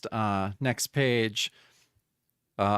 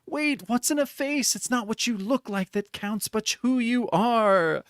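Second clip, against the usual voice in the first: kind plea; 10 semitones higher than usual. Acted. The doctor calming the monsters, high and tender. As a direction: gently talk them down. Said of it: "she's very kind".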